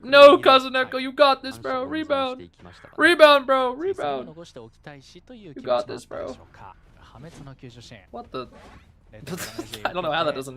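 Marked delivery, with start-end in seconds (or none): 0.0-6.4 high loud pep-talk cheer; rally him like a coach; encouraging cheer. Retold or imitated. Streamer cheering on a struggling teammate, loud encouragement. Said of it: "and I'm like".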